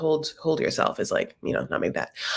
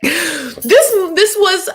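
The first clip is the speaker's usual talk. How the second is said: laughing high; 10 semitones higher, 6 dB louder, much slower than usual. Real, not acted.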